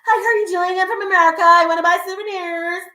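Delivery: high, loud and eager, a hammed-up American tourist. Tourist announcing she's American to a vendor, high and loud. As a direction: play the loud tourist who gives away where she's from. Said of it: "as soon as they see you and you're from".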